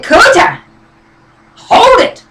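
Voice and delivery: high, loud cry to halt him; sharp call to stop. Retold or imitated. Wife confronting her husband before he leaves, high and loud. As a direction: stop him dead in his tracks.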